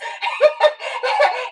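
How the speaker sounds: sudden high laugh; bright laughing burst; burst of high laughter. Real, not acted. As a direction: laugh out loud. Laughs mid sentence.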